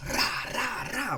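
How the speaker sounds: high, punchy rah rah noises; quick high mock battle cry; imitated gunfire. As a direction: sound off like shots fired as she storms in. Mom bursting in, shots fired.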